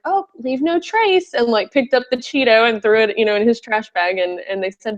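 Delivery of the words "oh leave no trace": slow, high, lilting leave-no-trace dad; cheery dad. Retold or imitated. The dad tossing the Cheeto, slow and singsong. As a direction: lightly mimic a chirpy hiking dad. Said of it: "the dad was like".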